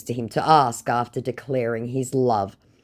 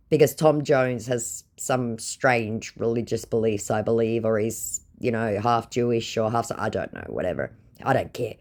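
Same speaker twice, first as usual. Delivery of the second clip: ordinary delivery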